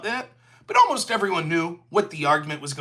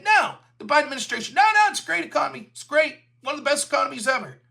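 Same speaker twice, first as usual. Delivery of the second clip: fast, higher, dismissive mock reassurance; 5 semitones higher, faster than usual. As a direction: rattle it off like a spin doctor brushing you off. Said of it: "They kept saying".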